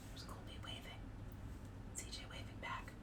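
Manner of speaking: soft whispered aside. Real, not acted. Reader whispering to kids about the illustration. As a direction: breathe it to the listeners.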